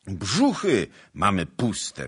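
loud, drawn-out complaint; complaining. A narrator's reading. Soldiers grumbling to their commander about hunger, complaining. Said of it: "z narzekaniem i skargami".

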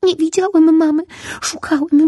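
put-on high little boy telling his tale; a small lost child explaining; little ray boy. A narrator's reading. (wysoki głos małego promyczka)

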